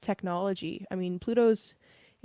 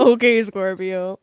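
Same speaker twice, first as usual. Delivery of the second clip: playful mock voice; 10 dB louder, slower than usual. Louder slow laughing put-on.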